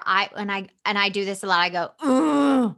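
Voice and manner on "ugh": drawn-out exasperated "ugh"; frustrated groan. Retold or imitated. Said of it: "with something I'm frustrated".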